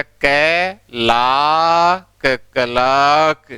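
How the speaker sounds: कै, a very long लाक, then joined slowly as "kalaak"; slow syllable-by-syllable blend with a silent r; sounded-out pronunciation. Shown as a demonstration. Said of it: "तो क्या होगा?".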